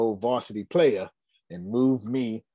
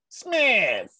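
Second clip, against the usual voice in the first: a sharp, higher bark of his name; imitating Coach Wallace; 10 semitones higher than usual. Coach barking at his player by name, mock stern. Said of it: "He talked like this".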